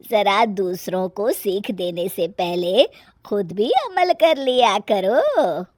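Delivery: put-on slow, drawn-out older-woman voice; measured mother-in-law chiding, last words stretched; mother-in-law, pointed reproof. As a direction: slowly deliver a dry moral dig.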